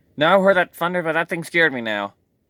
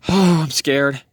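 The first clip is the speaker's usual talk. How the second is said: usual speaking voice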